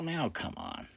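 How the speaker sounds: whispered, fed-up groan of words; whispered disbelief at the critics; exasperated whisper. Real, not acted. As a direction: hush it out in disbelief. Exasperated aside.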